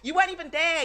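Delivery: shouted high and loud; furious high-pitched yell; angry shout. Real, not acted. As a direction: bursts out yelling. Angry rant at absent friend.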